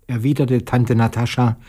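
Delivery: ordinary delivery, nothing marked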